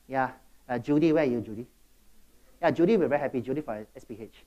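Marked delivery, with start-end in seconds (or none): none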